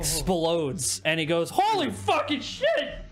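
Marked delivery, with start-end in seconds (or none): 1.5-3.0 high, drawn-out cry of shock; hammed-up stunned exclamation; shocked outburst. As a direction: yelp it slowly in disbelief. Vendor's phone explodes in his hand.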